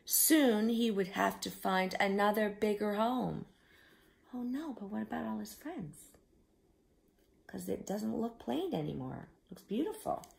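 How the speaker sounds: ordinary delivery, nothing marked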